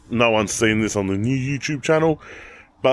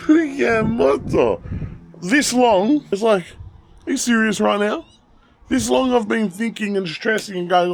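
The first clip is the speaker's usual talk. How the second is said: amused disbelief; 9 semitones higher than usual. Real, not acted. Laughing disbelief at car.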